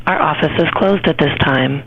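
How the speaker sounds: normal talking voice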